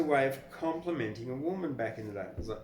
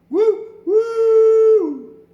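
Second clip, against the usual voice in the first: much higher, loud hooting woo like a steam whistle; train whistle; 20 dB louder than usual. Acted. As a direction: hoot it out like a goods train whistle. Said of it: "heard the goods trains whistle".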